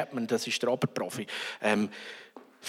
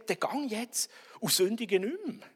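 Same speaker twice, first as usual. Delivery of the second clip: normal talking voice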